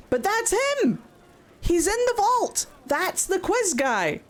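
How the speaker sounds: high-pitched, insistent outburst; indignant excitement. Real, not acted. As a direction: insist you've found the culprit, voice shooting up. Recognises the culprit in the game.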